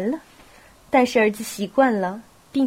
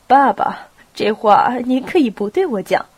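ordinary delivery

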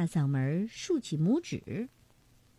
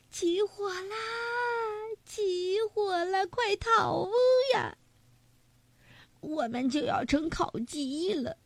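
panicked shouting; 9 semitones higher than usual. A narrator's reading. Fleeing the fire in panic.

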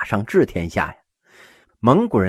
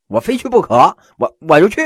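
stubborn insistence; 7 semitones higher, 7 dB louder, faster than usual. Acted. Uncle insists on visiting.

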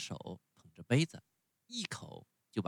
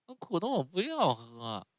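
put-on much higher, whiny little boy; puppet boy voice; 9 semitones higher, 5 dB louder than usual. A narrator's reading. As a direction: sulk like a spoiled child refusing medicine. Pinocchio voiced in story.

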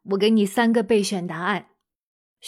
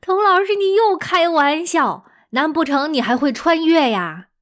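put-on high, loud teasing child voice; mock kid voice teasing the teacher; student teasing; 6 semitones higher, 4 dB louder than usual. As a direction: tease the teacher like a giggling pupil. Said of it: "有的同学肯定笑了".

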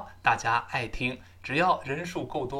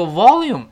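"volume" said loud and strong; emphatic loud "volume"; stress on volume; 6 semitones higher, 12 dB louder than usual. Shown as a demonstration. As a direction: lean hard on "volume". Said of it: "volume要重读".

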